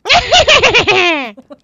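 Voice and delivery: high loud witch cackle; evil cackle. Shown as a demonstration. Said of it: "can you give me your best witch laugh".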